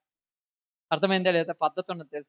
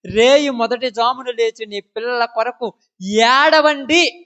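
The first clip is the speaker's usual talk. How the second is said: slow quote building to a loud cry; scripture declamation; 5 dB louder, much slower than usual. Acted. Preacher quotes the call to weep for children, declaiming. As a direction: proclaim it slowly, swelling on the last word.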